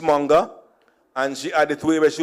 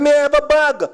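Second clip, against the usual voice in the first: put-on Jamaican accent; 10 semitones higher, 4 dB louder than usual. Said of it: "I said".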